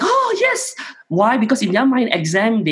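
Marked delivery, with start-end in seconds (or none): 0.0-0.7 excited yes. Retold or imitated. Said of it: "And it's like".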